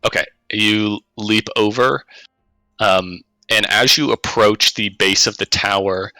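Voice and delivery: ordinary talk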